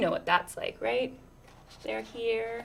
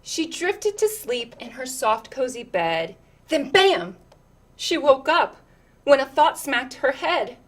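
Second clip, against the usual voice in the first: lilting, rhythmic read-aloud; picture book reading; 3 semitones higher, 9 dB louder than usual. Acted. Reading a picture book to children, lilting storyteller voice.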